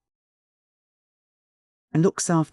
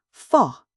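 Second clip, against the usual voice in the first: disgusted faugh; 5 semitones higher, 4 dB louder than usual. A narrator's reading. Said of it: "strong indignation".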